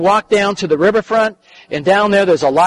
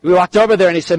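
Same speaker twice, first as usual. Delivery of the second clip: ordinary talk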